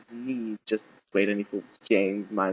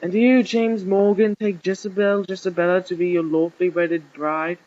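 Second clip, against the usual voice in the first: higher, ceremonious officiant voice; archbishop officiating; 6 semitones higher than usual. A narrator's reading. Archbishop asking the bride for her vow, ceremonious. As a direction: intone it formally, pitched up.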